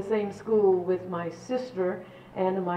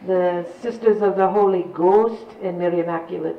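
lilting Irish brogue, louder; 5 dB louder than usual. Shown as a demonstration. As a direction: put on an Irish nun's brogue. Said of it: "I can do a great, uh, Irish brogue for you".

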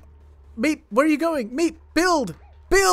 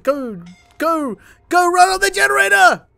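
high, loud desperate yell at the screen; frantic shout; 10 semitones higher, 9 dB louder than usual. Real, not acted. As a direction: bellow the order at your useless colonists. Yelling at game characters to flee.

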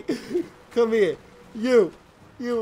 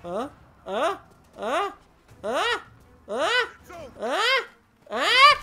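taunt, stepping higher every time; rising taunting huh; 5 semitones higher, slower than usual. Real, not acted. Gamer taunting an enemy, each huh climbing higher.

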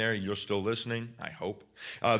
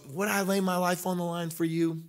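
musing doubter; 5 semitones higher, 4 dB quieter than usual. Retold or imitated. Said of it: "That just means goes".

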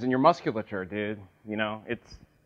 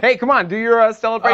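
loud, higher eager push; excited urging; 6 semitones higher, 6 dB louder, much faster than usual. Acted. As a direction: egg him on loudly. Urging friend to celebrate.

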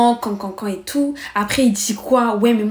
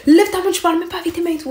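retold yelling; 7 semitones higher, faster than usual. Girl telling the boy off for using his hands, retold yelling. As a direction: rattle it off high like a half-voiced shout. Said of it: "je criais".

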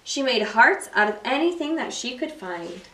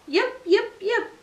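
high yipping fox call; fox yips; 9 semitones higher than usual. A narrator's reading. Little fox calling toward the music, high yips. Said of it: "She called".

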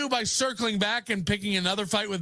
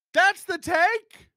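incredulous; 7 semitones higher, 5 dB louder, slower than usual. Real, not acted.

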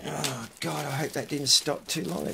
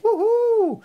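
high, loud whoop of joy; excited whoop; 9 dB louder than usual. Retold or imitated. Spotting the perfect power supply, a loud high whoop. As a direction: whoop it out like you found treasure. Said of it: "I thought".

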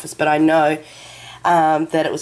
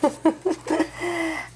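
soft high laugh; 10 semitones higher, 6 dB quieter than usual. Real, not acted. Moved by card.

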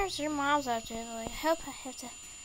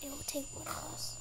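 whisper; 12 dB quieter than usual. Acted. Hiding, counting down quietly.

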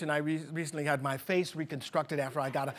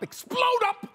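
sudden high burst; 3 dB louder than usual. Acted. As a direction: jump way up in pitch. Guest demonstrates move.

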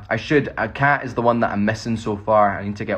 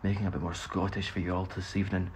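put-on Scottish accent; 4 semitones lower, 6 dB quieter than usual. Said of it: "doing my young Ian accent".

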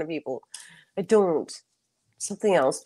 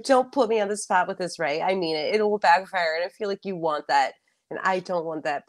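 ordinary delivery

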